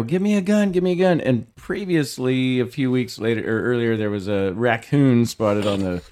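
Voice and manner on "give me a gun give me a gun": high-pitched, held-back imitation of yelling; retold scream. Husband recaps calling his wife for a gun, mock scream. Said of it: "screaming at Marital".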